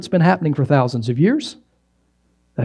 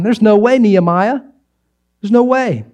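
jeering scoff; 5 semitones higher than usual. Retold or imitated. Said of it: "They're jeering".

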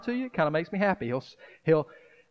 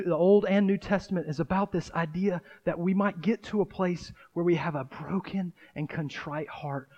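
ordinary talk, nothing marked

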